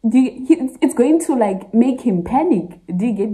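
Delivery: usual speaking voice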